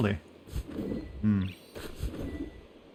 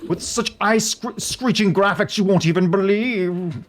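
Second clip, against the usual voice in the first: graphics hog voice; 8 semitones higher, slower than usual. Pretend. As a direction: hype it like a tech commercial. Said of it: "yo, hog voice".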